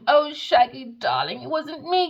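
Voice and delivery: high, loud whiny mock innocence; mocking fake victim. Mocking the Virgo playing the victim, high and whiny.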